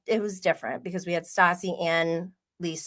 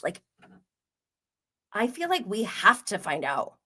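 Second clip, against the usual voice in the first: usual speaking voice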